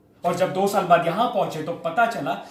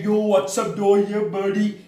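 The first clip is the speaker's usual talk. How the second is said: mock cool-kid slang. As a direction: stretch it out like a hip schoolboy greeting. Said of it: "ऐसे करके बात नहीं करते". (कूल अंग्रेज़ी बच्चों की खिंची हुई नकल)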